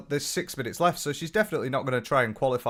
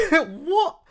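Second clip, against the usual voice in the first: a laughing, squeaked-high and louder what; incredulous laugh; 5 dB louder than usual. Real, not acted. Mocking the critic's claim with a high, laughing what. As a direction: disbelief bursting out in a high laugh.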